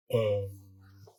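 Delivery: usual speaking voice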